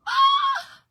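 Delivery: piercing high shriek, very loud; sudden shriek. Pretend. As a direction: let out a wild squeal of delight. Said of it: "I just felt like doing that".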